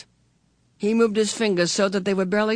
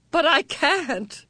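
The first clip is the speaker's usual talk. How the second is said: high, slow anguished cry; cracking tearful heartbreak; 10 semitones higher, slower than usual. Acted. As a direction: voice breaking with despair. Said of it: "cried in an anguished voice".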